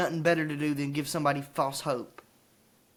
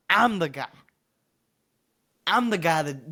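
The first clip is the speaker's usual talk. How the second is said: ordinary talk, nothing marked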